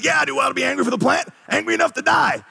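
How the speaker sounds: high, fast outburst, yelling it; angry shout. Retold or imitated. Jonah yells back at God in counseling, furious high shout. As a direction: blow up like a furious patient. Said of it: "And God said to me".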